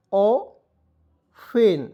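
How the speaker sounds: Often drawn out very slowly; slow model word. Shown as a demonstration. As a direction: stretch often for the class to copy. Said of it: "टी को इसमें silent रहेंगे". (Often को बहुत धीरे खींचकर)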